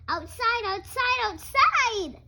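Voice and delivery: high, loud duckling shouts; excited yelling. Acted. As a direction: call it out like an eager duckling.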